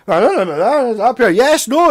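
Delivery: sock puppet voice. Retold or imitated. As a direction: play a sock puppet talking back. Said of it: "He puts a sock on his hand and goes".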